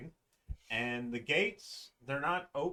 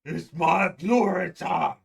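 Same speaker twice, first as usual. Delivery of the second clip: louder, slower, drawn-out triumphant yell; golem battle cry; 5 semitones higher, 11 dB louder than usual. Acted. Golem proclaiming his glory before a punch, yelling. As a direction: bellow it like a victory cry. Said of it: "you hear".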